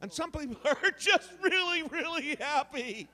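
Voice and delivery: very high, giddy happy voice; really happy. Shown as a demonstration. Demonstrating attitude to an audience, gleefully happy. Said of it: "now just add attitude".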